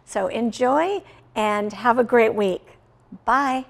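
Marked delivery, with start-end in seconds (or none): none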